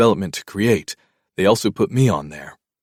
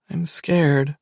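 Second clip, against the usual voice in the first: put-on higher, small frightened child; scared little girl; 8 semitones higher than usual. A narrator's reading.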